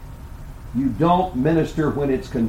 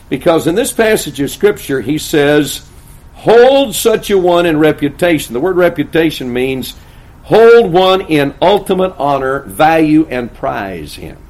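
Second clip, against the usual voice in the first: raised, emphatic pulpit voice; loud sermon exposition; full-force preaching; 4 semitones higher, 7 dB louder than usual. Acted. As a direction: drive the scripture home to the congregation.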